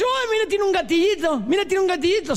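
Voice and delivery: very high, wondering joke voice; amazed discovery. Acted. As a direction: squeal it like a thrilled simpleton. Other man spots the trigger. (asombro agudo y exagerado)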